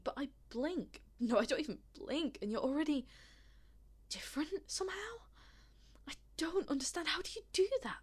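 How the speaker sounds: high-pitched, halting disbelief; alarmed bewilderment. Acted. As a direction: grope for an explanation, unnerved. Bewildered by a shifting face.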